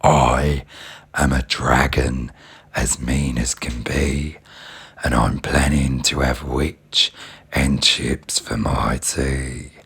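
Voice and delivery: very low menacing dragon growl; a mean dragon gloating. A narrator's reading. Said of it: "a roar that was scary and loud".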